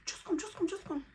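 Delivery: high, urgent repeated call; urgent excited calling. Retold or imitated. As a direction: hurry him in. Urging husband excitedly.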